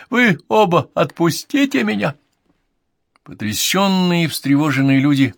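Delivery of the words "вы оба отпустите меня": high, loud, panicky squeal; girlish squeal. A narrator's reading. Podmore held by both neighbours, girlish squeal. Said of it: "визжал Подмор, как девчонка".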